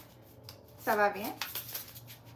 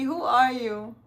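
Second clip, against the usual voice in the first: normal talking voice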